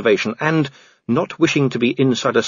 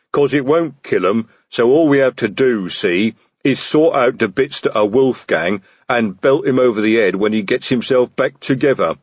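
stolid sergeant. Acted. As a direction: lay out the rough plan as a lumbering sergeant. Voices Detritus explaining his plan.

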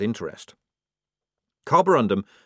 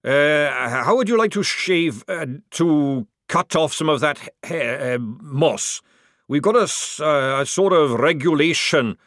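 flustered, tripping over words; nervous stammer; 5 semitones higher, 5 dB louder than usual. Acted. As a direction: stumble nervously through it, unsure. Sergeant nervously stammers at troll.

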